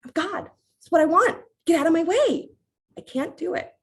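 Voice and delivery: high, loud and insistent; high, loud hammed-up rage; mock divine fury. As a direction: shout your demand as an angry god. Ram furious, then Varuna replies.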